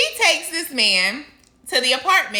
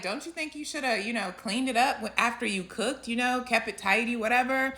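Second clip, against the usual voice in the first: gentle, much softer, careful not to offend; polite and soft; 7 dB quieter than usual. Retold or imitated. To his girlfriend about the dirty stove, soft and polite. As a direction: ease it to her kindly and quietly. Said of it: "very polite about it".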